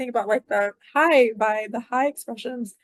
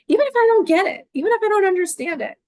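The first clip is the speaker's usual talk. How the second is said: much higher, faster and louder, teasing; playful high voice; 10 semitones higher, 4 dB louder, faster than usual. Retold or imitated. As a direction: toss it off in a bright squeaky put-on voice. Playfully voicing an onlooker.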